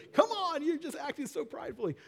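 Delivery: high exasperated mock scold. Pastor voices James scolding the proud. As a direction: tease like a fed-up friend. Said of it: "he's like".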